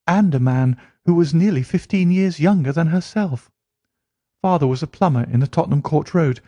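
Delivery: high, eager voice of a gossiping typist; Mary telling Holmes about her mother's remarriage, high and eager; chatty young woman. Acted. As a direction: rattle through the family history. Mary tells family story.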